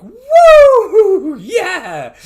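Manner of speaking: loud, high whoop of delight; whooping reveller. Acted. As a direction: let out a wild party holler. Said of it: "hear Tiberius outside being like".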